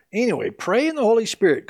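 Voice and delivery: usual speaking voice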